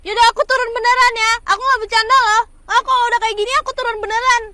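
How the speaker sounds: loud, forceful warning; heated and insistent; angry threat. Acted. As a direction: threaten loudly that you mean it. Quarrel, she threatens to get out.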